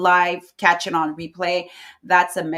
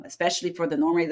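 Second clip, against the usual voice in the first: usual speaking voice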